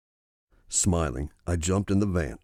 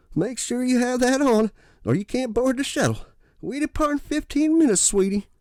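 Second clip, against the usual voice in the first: put-on high, bossy-sweet old lady voice. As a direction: instruct like a sweet but firm grandmother. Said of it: "An elderly woman".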